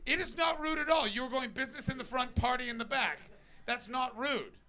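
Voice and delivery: loud, high-pitched mock outrage; comic yelling. Real, not acted. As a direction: yell back at the heckler, pitched high. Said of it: "I'll keep yelling".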